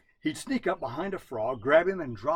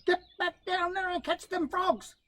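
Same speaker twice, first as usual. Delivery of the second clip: much higher, scolding order; angry wife. Acted. Wife ordering husband back to the river, high and scolding. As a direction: drive him back to the river.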